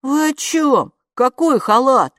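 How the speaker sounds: loud, high puzzled questions; baffled, pitch jumping up; surprised. A narrator's reading. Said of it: "удивился Оскар".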